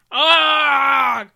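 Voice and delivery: loud, full-throated battle scream; angry scream. Acted. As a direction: let out a furious charging yell. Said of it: "screamed Noah".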